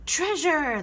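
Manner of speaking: high-pitched pirate echo. A narrator's reading. Pirate crew repeating about treasure, excited pirate echo. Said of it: "the pirates repeated".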